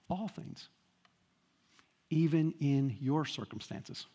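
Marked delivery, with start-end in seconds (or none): 0.1-0.7 All things, All hit first and soft with a rise; stress on all. Shown as a demonstration. Preacher showing the Greek emphasis, All stressed. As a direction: lean on All, quietly. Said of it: "what's the emphasis?".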